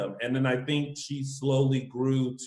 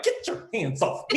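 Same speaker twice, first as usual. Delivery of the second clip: imitated indignant protest; 7 semitones higher than usual. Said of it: "She was like".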